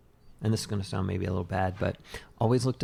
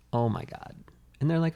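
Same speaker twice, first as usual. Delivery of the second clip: usual speaking voice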